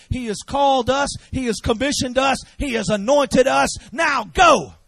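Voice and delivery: high, loud, shouted commissioning; full-force preaching. Acted. Pastor sending the church into Camden and beyond, shouting. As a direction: send them out with every line hit hard.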